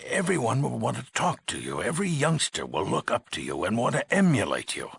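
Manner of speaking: agitated warning. Acted. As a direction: build the worry with big pitch swings. Overseer foresees youngsters copying hero.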